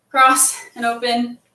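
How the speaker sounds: ordinary delivery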